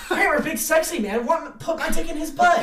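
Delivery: high, loud, laughing mock ramble; hammed-up broken English. Retelling the audition rant, high and laughing. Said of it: "in broken, like, English that didn't make any sense".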